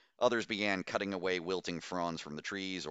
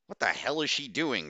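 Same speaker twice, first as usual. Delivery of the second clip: higher, louder outburst; startled outburst, pitched up; bursting out; 6 semitones higher, 5 dB louder than usual. A narrator's reading. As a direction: blurt it out in disbelief. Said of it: "Kraft burst out".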